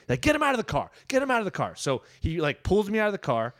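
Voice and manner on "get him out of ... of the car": mock yell, pitched high without real volume; urgent high-pitched imitation of a shouting cop; retold screaming. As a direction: imitate a cop barking an order, held back. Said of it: "the cop is screaming".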